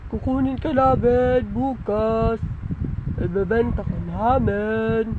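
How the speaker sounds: a lightly disguised voice, calm and a bit ominous; pretend stranger on phone. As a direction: pose as a stranger calling to scare the child. Pretend caller threatens child. (kunwaring boses ng estranghero sa telepono)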